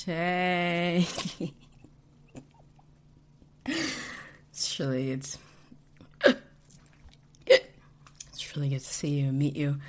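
put-on sloppy slurred drunk, laughing; loose, giggly drunkard. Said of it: "drunkard voice module".

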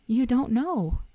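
incredulous, forceful emphasis. A narrator's reading. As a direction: say it in amazed disbelief. Hagrid stunned, repeats more forcefully.

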